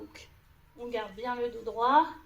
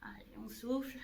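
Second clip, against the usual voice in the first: whispered; 7 dB quieter than usual. Real, not acted. Coach holding the side plank.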